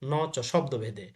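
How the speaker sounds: usual speaking voice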